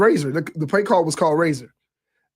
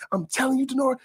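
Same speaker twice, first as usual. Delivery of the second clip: higher, softer insisting coach; lightly voiced coach pleading by name; animated coach; 9 semitones higher, 4 dB quieter than usual. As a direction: coach insisting to his quarterback, mildly imitated. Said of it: "Borges get animated".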